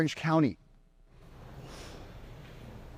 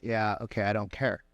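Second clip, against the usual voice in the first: low, quiet, unimpressed shrug; bored dismissive; 7 semitones lower, 4 dB quieter than usual. Retold or imitated. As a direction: brush it off, clearly bored. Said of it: "and you're just like".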